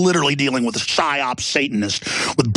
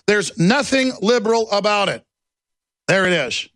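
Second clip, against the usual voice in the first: ordinary delivery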